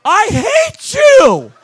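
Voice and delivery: fan's imagined thought about the preacher, hammed-up hatred; mock hatred. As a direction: drag out a hammed-up hateful line. Mock inner voice of the fan.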